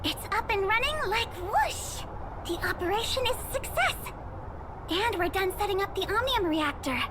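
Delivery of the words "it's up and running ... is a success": excited. Acted. Engineer reporting the reactor is up and running, excited. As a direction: report the success with glee.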